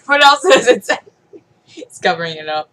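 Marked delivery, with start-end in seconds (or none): 0.1-1.0 laughing. Real, not acted. Laughing at the toy.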